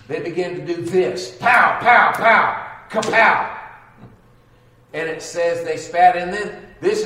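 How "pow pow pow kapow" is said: loud, high pow pow sound effects; imitated punches, loud and high; punching sounds. Shown as a demonstration. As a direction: punch out each pow like blows. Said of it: "Buffet means this".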